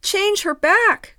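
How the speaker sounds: high, loud, slow plea; pleading cry. Acted. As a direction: beg loudly for it. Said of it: "cried the children".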